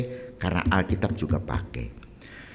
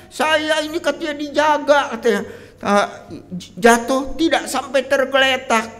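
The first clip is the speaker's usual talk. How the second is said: much higher and louder, a wounded whining protest; complaining to God; 8 dB louder than usual. Acted. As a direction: accuse God like a betrayed child. Voicing a believer's complaint against God. (mengeluh kepada Tuhan, suara tinggi dan keras)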